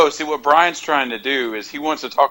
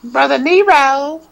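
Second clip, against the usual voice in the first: much higher, slow British-southern drawl; Matt Hardy voice; 4 dB louder, slower than usual. Retold or imitated. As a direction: ham up the British accent with a southern twang. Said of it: "mix in the British accent with the southern twang".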